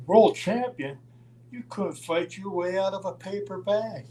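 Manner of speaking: high-pitched scoffing disbelief; mocking taunt. Ole taunting Roddy about being world champion, mocking. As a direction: hoot it derisively, pitched well up.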